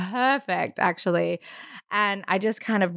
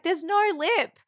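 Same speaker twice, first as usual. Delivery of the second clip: higher-pitched, reassuring midwife voice; bright, higher reassurance from the midwife at the pool; 8 semitones higher than usual. Retold or imitated. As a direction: lift the voice up high and soothe her, she's nearly there. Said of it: "she was like".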